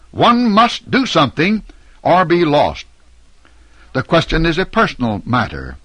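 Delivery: usual speaking voice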